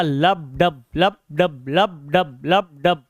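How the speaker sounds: low, rhythmic lub dub like a heartbeat; teacher drops low to mimic the heartbeat; heartbeat sound demo. Shown as a demonstration. Low rhythmic heartbeat imitation for the students. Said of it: "heart beat का sound क्या होता है".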